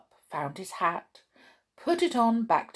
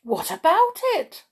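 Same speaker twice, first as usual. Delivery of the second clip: high, loud startled question; 11 semitones higher, 6 dB louder than usual. Acted. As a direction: jump at the news.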